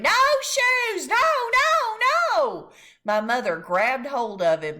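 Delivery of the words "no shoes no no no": high, loud tantrum scream; toddler screaming. A narrator's reading. Fudge screaming while kicking on the shoe store floor. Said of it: "He screamed".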